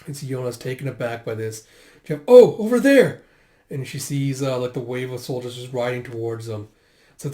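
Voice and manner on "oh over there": high, loud shout of discovery; calling out. Retold or imitated. Spotting the wave of soldiers, loud alarmed call. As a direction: shout to point someone out.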